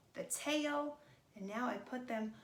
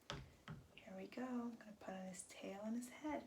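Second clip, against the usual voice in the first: a soft, secretive hush; whisper; 7 dB quieter than usual. Acted. Crafter murmuring over her pieces, whispered. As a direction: keep it just above breath.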